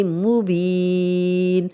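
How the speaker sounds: مبین said slowly, stopped with sukun; slow tajweed recitation; recited pause form. Shown as a demonstration. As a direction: stop on مبین with no ending. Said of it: "نہیں رکیں گے تو مبینن".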